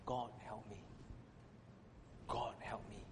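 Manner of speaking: soft, exhausted plea repeated; barely voiced, worn-out prayer; tired whispered prayer. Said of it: "and I just whisper".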